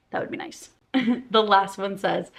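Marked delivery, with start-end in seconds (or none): 0.1-0.7 unvoiced wistful whisper; whispered wish. Real, not acted. Wishing to unread the book, trailing whisper. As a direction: breathe it out wistfully.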